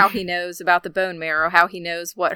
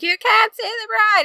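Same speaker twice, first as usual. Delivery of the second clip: high shrieking yell, much higher and louder; screaming; 5 dB louder than usual. Retold or imitated. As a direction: shriek it at the groom like a panicked bridesmaid. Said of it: "they're all screaming at him".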